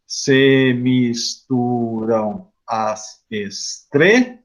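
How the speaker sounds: very slow, syllable-by-syllable scansion; counting syllables. Shown as a demonstration. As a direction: draw out every syllable and stop on TRE. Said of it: "No segundo tem sete". (escandindo o verso sílaba por sílaba)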